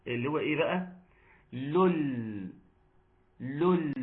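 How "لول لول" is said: "لول" held long on a clear final l; lām demonstration. Shown as a demonstration. Teacher isolates the sound to check it is audible, "لول" drawn out. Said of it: "الصوت اللي أنا بقوله ده".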